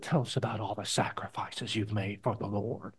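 breathy whispered question from an admirer; a soft mock whisper from someone in awe; hushed question. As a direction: whisper it like a reverent fan asking. Someone asks Studd about sacrifices.

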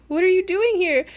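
high, loud flurry of questions; incredulous demanding. Retold or imitated. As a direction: fire off questions in loud disbelief. Retold shocked questions at reunion.

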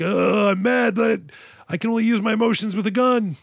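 hammed-up action-hero outburst, pitched high; mock anguished shout with little added volume; Point Break style. Venting feelings through a gun, hammed-up action-hero anguish. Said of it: "Point Break style".

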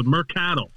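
mer-CAD-al, stress on the middle of Mercadal; stress on second syllable. Shown as a demonstration. Man demonstrating how people botch his surname, second syllable stressed. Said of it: "the accent on the second one".

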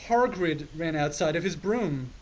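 ordinary delivery

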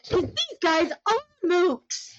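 high, loud burst of real excitement; excited. Real, not acted. Player announcing an edge against these mooks, real excitement. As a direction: blurt it out, thrilled and high-pitched. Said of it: "I'm so excited right now".

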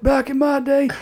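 very high, put-on old-timer voice; old person voice. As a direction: redo the old-timer bit. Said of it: "I made that old person voice".

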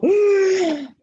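a sudden gasp of air; sharp gasp. Retold or imitated. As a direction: gasp like breaking the surface. Surfacing after hold down.

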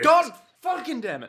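high, loud cursing; exasperated outburst. Acted. Listener reacting to "to be continued", exasperated outburst. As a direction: burst out in frustration.

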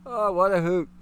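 chuckling as he speaks; amused laughing. Real, not acted. Host enjoying a chat joke, amused laugh. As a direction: savor the joke through laughter. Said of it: "Reason why I'm laughing".

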